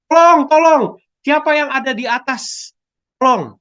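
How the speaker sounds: high, loud desperate cry for help from the cliff; shouting for help. Acted. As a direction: yell up the cliff, begging someone to hear. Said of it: "Dia mulai berteriak".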